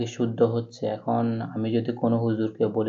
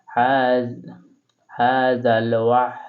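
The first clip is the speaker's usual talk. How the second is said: slow joined reading; slower than usual. Shown as a demonstration. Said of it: "আপনারাও আমার সাথে সাথে পড়ুন".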